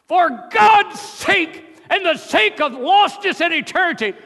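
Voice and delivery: full-force, very high shouted plea; screamed exhortation. Real, not acted. Preacher urging ladies and gentlemen to wake up, screamed. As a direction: scream it out to rouse the whole congregation.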